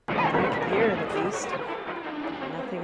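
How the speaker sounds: loud, fed-up outburst; loud exasperation. Real, not acted. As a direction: push it out in frustration. Venting over frozen game.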